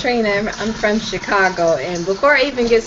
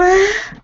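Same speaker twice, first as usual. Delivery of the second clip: high giggle; 12 semitones higher than usual. Real, not acted. Guest giggles after joke.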